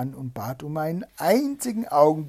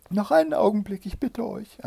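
high-pitched, urgent begging; pleading wife; 9 semitones higher, 7 dB louder than usual. A narrator's reading. Wife begs Bluebeard for a moment, pleading. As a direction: beg for one moment in a high voice.

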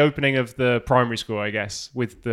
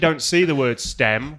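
ordinary talk, nothing marked

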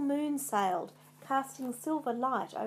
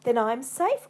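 loud, triumphant cry; 8 dB louder than usual. A narrator's reading. Macbeth hearing the prophecy, crying out in triumph. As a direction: burst out with relieved confidence. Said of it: "cried Macbeth".

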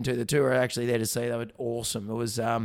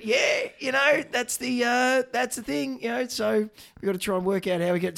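knowing; 9 semitones higher than usual. Retold or imitated. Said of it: "I go".

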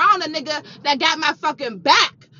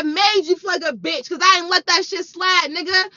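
angry scolding; 2 semitones higher, 4 dB louder than usual. Retold or imitated. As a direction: chew him out for making excuses. Berating ex for excuses.

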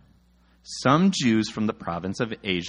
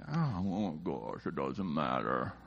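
low, slow, soft sigh of defeat; weary exasperation; 9 semitones lower, 11 dB quieter, slower than usual. Retold or imitated. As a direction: drag it out quietly, worn down. Dad wearily dismissing the squabble.

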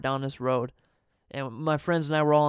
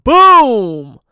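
loud high boom of shattering glass; a big shouted boom; crash sound; 9 semitones higher, 17 dB louder than usual. Retold or imitated. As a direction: belt out the impact noise. Skateboard hits the windshield.

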